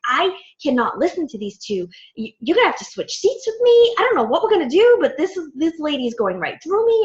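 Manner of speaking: retelling how she lost patience at dinner, voice climbing; exasperated outburst. Retold or imitated. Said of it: "I've never seen you like this".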